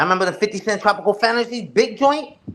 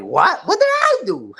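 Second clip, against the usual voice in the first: high squeaky mock innocence, much higher; mock innocent friend; 11 semitones higher, slower than usual. Imitating friend Cynical's high fake innocent "what did I do". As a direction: play him as falsely innocent, voice jumping up. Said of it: "I know Cynical was like".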